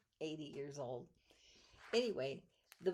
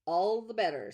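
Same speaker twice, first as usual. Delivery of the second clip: booming cheerful call; loud groundhog; 4 semitones higher, 13 dB louder than usual. Acted. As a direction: call out big and bright. Groundhog pops up and speaks.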